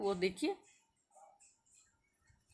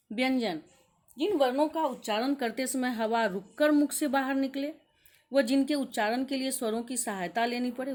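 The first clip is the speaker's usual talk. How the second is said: usual speaking voice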